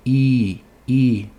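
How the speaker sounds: a lone voiced "I" vowel, held out; som de I. Shown as a demonstration. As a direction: sound out I as a vibrating vowel. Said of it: "Y faz som de I". (I vozeado, isolado)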